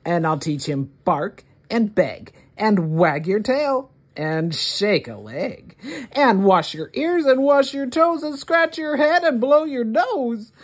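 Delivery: plain reading voice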